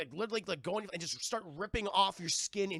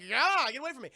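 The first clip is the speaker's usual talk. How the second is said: mock yell; 7 semitones higher, 7 dB louder than usual. Heroine voiced in recap.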